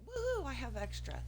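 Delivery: excited. Retold or imitated. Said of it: "I got excited. I thought".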